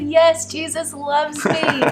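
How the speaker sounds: put-on mother tells her kids Jesus loves her, overjoyed. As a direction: gush it out, overwhelmed with happiness. Answering kids, overcome with joy.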